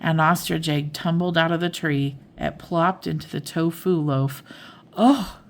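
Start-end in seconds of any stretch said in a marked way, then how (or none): 5.0-5.4 high, louder startled oh; gasp. A narrator's reading. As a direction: gasp at the falling egg. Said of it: "gasped Emma and Lulu".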